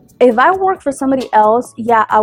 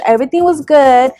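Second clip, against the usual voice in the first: normal talking voice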